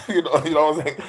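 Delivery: normal talking voice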